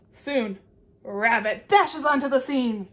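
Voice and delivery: ordinary delivery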